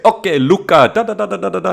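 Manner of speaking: put-on high, loud Portuguese-accented mum giving orders; Portuguese mom accent. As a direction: imitate a Portuguese mother instructing her son. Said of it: "strong Portuguese accent".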